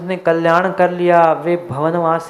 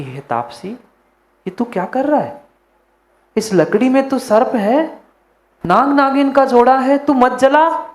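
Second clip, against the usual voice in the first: rising to a high, urgent plea; urgent warning; 6 semitones higher than usual. Acted. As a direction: warn him off the fire, more and more alarmed. Prince warns ascetic burning wood.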